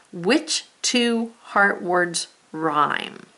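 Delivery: very slow, each word spaced out; slow read-along. Shown as a demonstration. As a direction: read it slowly with the class. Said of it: "let's read that again".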